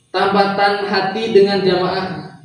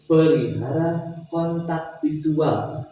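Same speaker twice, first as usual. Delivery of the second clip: lowered slow delivery; 4 dB quieter, much slower than usual. Shown as a demonstration. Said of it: "turunkan nadana".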